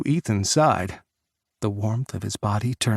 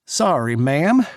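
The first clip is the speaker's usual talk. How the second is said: lightly voiced cowboy drawl, higher; cowboy twang; 4 semitones higher, 5 dB louder, slower than usual. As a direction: tip the hat with a hint of Texas. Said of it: "in his best cowboy twang".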